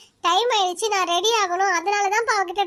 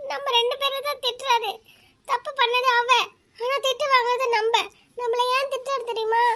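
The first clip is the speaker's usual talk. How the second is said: put-on higher, sulky child's whine to mother; a spoiled daughter whining that dad loves her less; whiny complaint; 4 semitones higher than usual. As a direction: pout and whine for sympathy.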